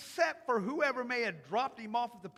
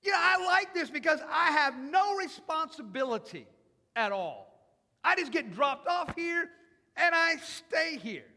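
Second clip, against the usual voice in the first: loud, higher, smug contentment; 5 semitones higher, 7 dB louder than usual. Retold or imitated. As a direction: boast loudly about liking it here. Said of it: "he could have said this".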